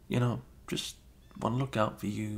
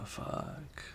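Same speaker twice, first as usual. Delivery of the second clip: whispered curse; 11 dB quieter than usual. Acted. Embarrassed realization, muttered curse.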